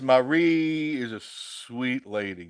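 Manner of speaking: slow, loud, stretched-out praise; drawn-out compliment. Pretend. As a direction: stretch every word out playfully. Playfully reading a compliment to Marie.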